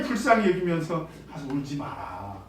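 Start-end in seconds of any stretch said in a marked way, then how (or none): 1.3-2.4 lower, softer and slow, a tender hint of comfort; gentle comfort. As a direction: say it softly to a grieving mother. Jesus comforting the grieving widow. (부드럽고 느리게 위로하듯)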